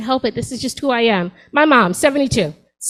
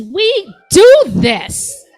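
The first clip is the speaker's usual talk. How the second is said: showing off; 7 semitones higher, 6 dB louder, much slower than usual. Acted. Showing off aging well.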